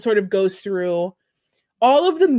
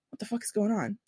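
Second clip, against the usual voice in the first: a much softer, breathless stunned question; half-whispered disbelief after a gasp; stunned hush; 10 dB quieter, faster than usual. Retold or imitated. A viewer reacting to a wild twist, hushed and stunned. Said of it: "you'll just be like".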